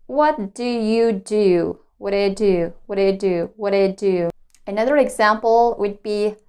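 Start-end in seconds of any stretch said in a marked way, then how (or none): 0.1-4.3 "What do you do" linked as /wʌt a tu/; connected speech. Shown as a demonstration. Teacher drills linked "what do you do". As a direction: glide through with reduced "do you". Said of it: "I connect those words and I say".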